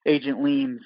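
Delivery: ordinary delivery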